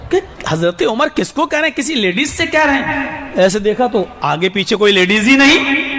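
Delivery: ordinary talk, nothing marked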